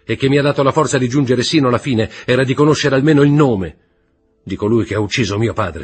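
fast, high, loud outpouring; desperate rush. A narrator's reading. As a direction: spill it all out in one anguished breath. Franz despairs, rushing on.

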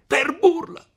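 very high, loud falling yell; furious shout. Acted. Renzo shouting down at seated Agnese, furious. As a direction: explode with the word in full rage. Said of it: "gridò Renzo".

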